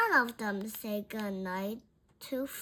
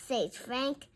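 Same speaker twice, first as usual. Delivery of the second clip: plain reading voice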